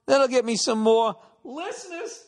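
a lightly voiced guy proud of his joke; mildly hammed-up gloating; smug self-satisfied. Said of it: "Just sitting there laughing".

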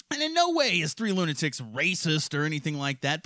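ordinary delivery

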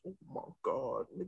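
very soft, low reaction; hushed disbelief. Retold or imitated. Viewer reacting to Tommy's retort, hushed and stunned. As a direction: drop right down, stunned.

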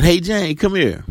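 retold yell to catch someone's attention; mock yell. Joe calling Jane over at work, mild mock yell. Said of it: "he yells out".

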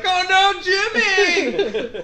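high, loud hammed-up wail; fake-mourn your friend; mock horror. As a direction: players faking grief over a killed friend, loud mock wail. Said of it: "they're like".

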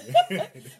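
sudden high laugh; high burst of laughter. Real, not acted. Laughing at palms joke.

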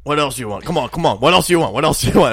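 loud, pushy hurry-up; mock old man rushing the kids; impatient grandpa. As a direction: grandpa barking at the kids to order. Grandpa rushing kids' order.

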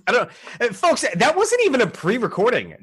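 ordinary delivery

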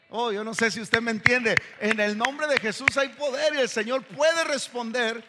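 impassioned pulpit delivery; full-force preaching. Acted. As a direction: build the preaching up with fervour.

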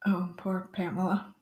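whispered mock pity; whispering. Real, not acted. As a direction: breathe it in a whisper.

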